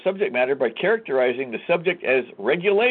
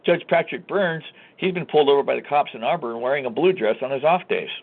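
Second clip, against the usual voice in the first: normal talking voice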